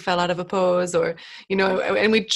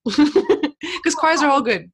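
laughing; 6 semitones higher, 6 dB louder, much slower than usual. Real, not acted. Laughs while saying it.

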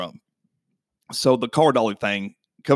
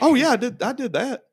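high, laughing mock confession; mocking the mechanic; 12 semitones higher than usual. Owner mocking the mechanic's admission, high and laughing. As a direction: ham up his sheepish admission with a laugh. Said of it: "he's like".

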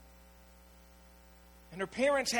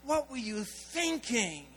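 mild angry scolding; slower than usual. Retold or imitated. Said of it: "he just goes off on her".

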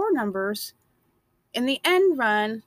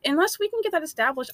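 ordinary delivery

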